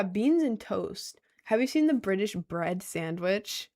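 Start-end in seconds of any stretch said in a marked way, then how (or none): none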